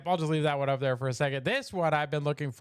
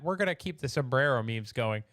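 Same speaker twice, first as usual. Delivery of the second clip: ordinary talk, nothing marked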